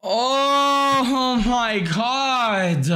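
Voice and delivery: high, long-drawn cry of disbelief; stunned exclamation. Real, not acted. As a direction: stretch it out slowly, high-pitched. Gamer reacts to game event.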